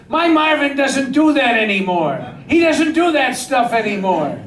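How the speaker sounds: high, indignant imitation of a wife; protesting wife impression. Arsonist's wife refuses the director's request, protesting in a high voice. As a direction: play a wife loudly standing up for her husband.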